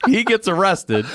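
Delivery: high voice squeezed through laughter; real laughing speech. Real, not acted. Storyteller revealing the arrest, high and laughing. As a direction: get it out while cracking up.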